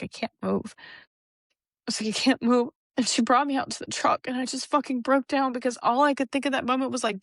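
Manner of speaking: high, tremulous voice close to tears; voice breaking. Real, not acted. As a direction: let the grief crack through the words. Tearful retelling of losing the child.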